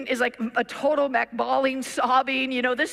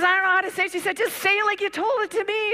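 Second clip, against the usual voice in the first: anxious; 10 semitones higher, 4 dB louder, faster than usual. Retold or imitated. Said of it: "she goes".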